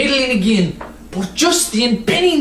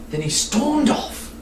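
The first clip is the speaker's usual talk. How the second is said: ordinary delivery, nothing marked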